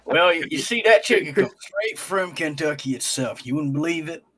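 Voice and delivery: hammed-up Southern yarn-spinning; mock Southern accent. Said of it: "he's suddenly from the south".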